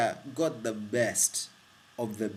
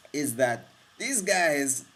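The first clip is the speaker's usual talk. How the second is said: normal talking voice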